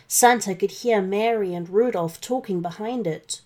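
ordinary delivery, nothing marked

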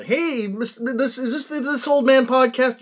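a caller pitching up to ask. Pretend. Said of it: "he takes a call from a".

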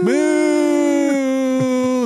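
high, long heckle; playful drawn-out boo; mock booing. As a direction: jeer along in a high hoot.

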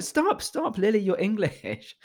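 high-pitched mock protest; retold protest. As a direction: cut her off, voice jumping up high. Retold plea to drop the accent.

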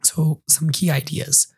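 ordinary talk

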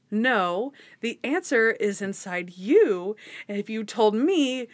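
emphatic retort building to a high, loud finish; hammed-up indignation, climbing at the end; mock exasperation. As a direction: act out a frustrated patient losing patience. Acts out exasperated patient arguing.

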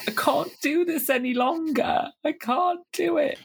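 high, whiny mock despair; mock despairing Craig. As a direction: wail it high and defeated. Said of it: "Craig, during his wobble, is also like".

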